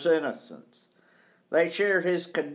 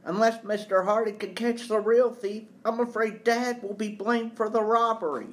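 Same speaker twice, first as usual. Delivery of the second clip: lightly voiced anxious son, pitched higher; a higher, mildly worried boy's voice; 7 semitones higher than usual. Son fears for his accused father, mildly worried.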